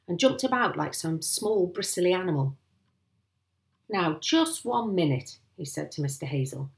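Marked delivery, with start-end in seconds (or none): 3.9-5.4 slow, higher-pitched halting order; ponderous policeman stepping in; officious interruption. Acted. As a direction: draw it out to stop him. Sergeant halts the angry landowner.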